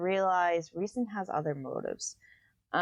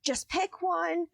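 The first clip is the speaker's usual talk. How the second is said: high strained plea; 11 semitones higher, slower than usual. Retold or imitated. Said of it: "he goes".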